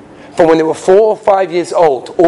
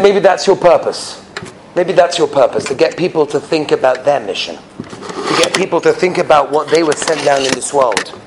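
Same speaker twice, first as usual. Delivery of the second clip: tender; 4 semitones lower than usual. Acted. Rabbi quoting the answer to the boy, low and tender.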